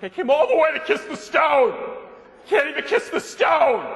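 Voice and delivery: loud, high, outraged yell; flying off the handle. Retold or imitated. Lady complaining at the Blarney Castle guide, outraged shout. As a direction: act out a tourist's shouted tantrum. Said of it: "This lady flew off the handle".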